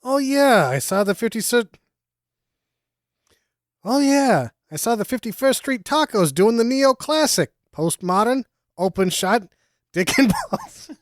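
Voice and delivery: excited gushing. Pretend. Hammed-up excited art-critic gush.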